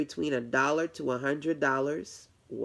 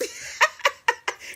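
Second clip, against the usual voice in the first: high breathy laugh; 4 dB louder than usual. Real, not acted. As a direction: giggle high and breathy at the compliment. Flattered by a compliment.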